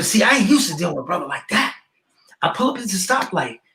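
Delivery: ordinary talk, nothing marked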